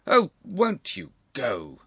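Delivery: slow, loud, high grim demand. A narrator's reading. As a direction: press each word grimly. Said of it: "asked Sir Charles grimly".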